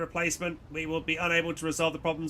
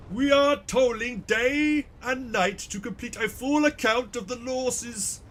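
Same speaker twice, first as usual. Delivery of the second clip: higher, slowed official drone; High Factorum voice; 6 semitones higher, slower than usual. Acted. High Factorum reporting to the Lord Captain in a higher, slow voice. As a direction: play a fussy senior official, measured and slow. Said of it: "I'm trying so hard with these voices".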